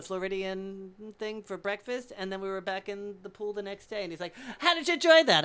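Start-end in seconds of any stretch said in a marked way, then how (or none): 4.6-5.4 very high, loud, showy question; flamboyant old man. Retold or imitated. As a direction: crow it as the proud extravagant host. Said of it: "so extravagant and flamboyant".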